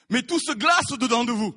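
ordinary delivery

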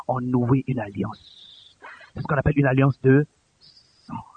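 low, soft, weighty storytelling; hushed solemn preaching. Real, not acted. Preacher recounting the night of the covenant, hushed and solemn. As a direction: tell it quietly, pausing between phrases.

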